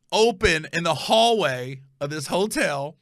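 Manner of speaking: normal talking voice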